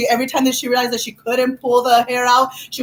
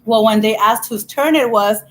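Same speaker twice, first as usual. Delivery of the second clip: usual speaking voice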